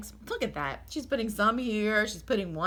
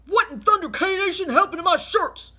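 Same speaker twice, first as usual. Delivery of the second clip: big, much louder, higher furious roar; 12 semitones higher, 12 dB louder than usual. Acted. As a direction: bellow in outrage at the ruined shirts.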